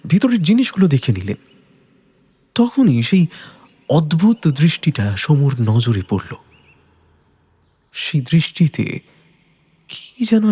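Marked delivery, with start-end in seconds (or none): none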